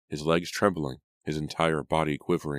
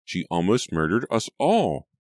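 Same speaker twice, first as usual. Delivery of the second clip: loud, high outburst; raised voice, appalled at the near murder; horrified accusation; 5 semitones higher, 5 dB louder than usual. A narrator's reading. Sister accuses maid in horror.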